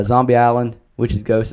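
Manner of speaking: ordinary delivery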